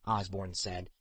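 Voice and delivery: plain reading voice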